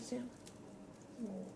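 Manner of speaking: usual speaking voice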